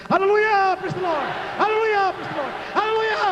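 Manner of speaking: high, ringing cry of praise; loud praise shout. Real, not acted. Preacher leading the congregation in three cheers, shouting high. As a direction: shout it out to lead the crowd. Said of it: "sumigaw ka ng malakas na hallelujah".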